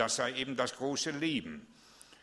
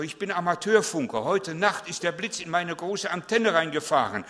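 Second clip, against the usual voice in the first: high, loud and urgent retelling of the night; excited teenager; 8 semitones higher, 8 dB louder than usual. Retold or imitated. Teen telling the pastor about the lightning, high excited voice. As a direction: blurt out the lightning story, shaken and eager. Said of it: "Sagt er".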